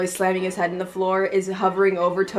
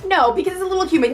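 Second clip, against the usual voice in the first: high mock reply; 10 semitones higher, 3 dB louder, faster than usual. Acting out a meme dialogue.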